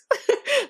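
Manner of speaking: quick high burst of laughter; short high laugh. Real, not acted. Laughing that the betrayer is now ruined. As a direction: laugh out at her downfall.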